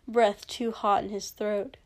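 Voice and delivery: ordinary delivery, nothing marked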